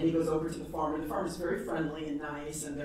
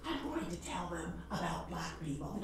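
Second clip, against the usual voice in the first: much softer, hushed confiding line; stage whisper; 11 dB quieter than usual. Retold or imitated. Speaker leaning in to tell the clerk her plan, whispered. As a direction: lean in and whisper the secret. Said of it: "I did a real stage whisper".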